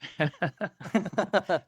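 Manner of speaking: short amused laugh; quick warm chuckle; light laugh. Real, not acted. Laughs at accent joke.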